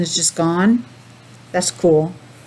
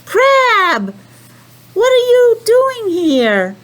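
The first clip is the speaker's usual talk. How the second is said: high, slow, amazed little penguin; surprised penguin voice; 13 semitones higher, 4 dB louder, much slower than usual. Acted. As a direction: call out in wonder to a friend. Penguin greets crab in story.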